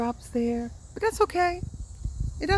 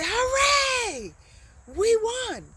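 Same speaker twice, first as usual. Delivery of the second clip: hooray much higher and louder; excited hooray; 5 dB louder, much slower than usual. Shown as a demonstration. Teacher shows the right way to cheer a win, excited. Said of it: "You'd say".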